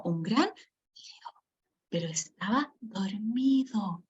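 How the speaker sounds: soft, slow "pero estaba dormido" after a pause; hushed storytelling. Shown as a demonstration. Teacher telling children the lion was asleep, hushed. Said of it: "un tono de voz más bajito".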